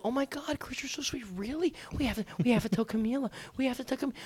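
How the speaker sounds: high, soft, breathless mock girl voice; gushing young women. Gullible girls falling for his act, mock gushing. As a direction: squeal softly and quickly, imitating smitten girls.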